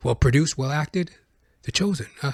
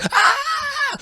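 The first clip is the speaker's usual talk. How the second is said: very high mock scream; answering scream. Comedian joins the neighbor, screaming in mock horror. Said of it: "And I'm like".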